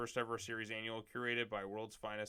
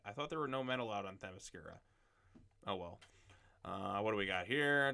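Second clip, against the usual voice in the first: ordinary talk, nothing marked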